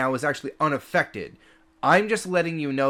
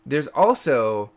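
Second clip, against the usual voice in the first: ordinary talk, nothing marked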